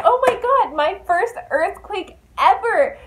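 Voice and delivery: high, loud, thrilled shriek; excited squeal. Retold or imitated. Aline thrilled at her first earthquake, shrieking high and loud. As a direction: gush over the first earthquake like a kid. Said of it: "she starts getting excited".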